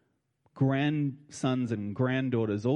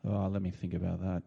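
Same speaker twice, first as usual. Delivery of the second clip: mock-serious pondering, lower, ending in a laugh; teasing fake refusal; joking; 7 semitones lower, 5 dB quieter than usual. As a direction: pretend to weigh it up, then laugh.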